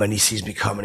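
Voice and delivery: usual speaking voice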